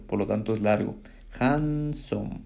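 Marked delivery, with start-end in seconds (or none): 1.4-2.4 higher "Handsome", split into two drawn-out syllables. Shown as a demonstration. Teacher counting two syllables for students, "Handsome" syllable by syllable. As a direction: draw out both syllables of "Handsome". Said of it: "Son dos".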